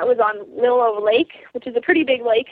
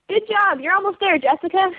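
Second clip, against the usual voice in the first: raised pitch, cheery encouraging call; cheering on a musher; 7 semitones higher than usual. Retold or imitated. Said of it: "And they go".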